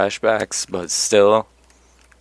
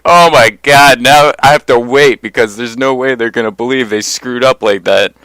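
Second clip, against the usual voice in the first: retold panic; 6 dB louder than usual. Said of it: "I'm like".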